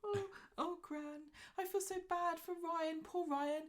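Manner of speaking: high, whimpering mock self-pity; mock whiny Alia. Mocking Alia moaning to Gran, high fake whimper. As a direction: put on fake tears for Gran. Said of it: "Alia's sitting there like".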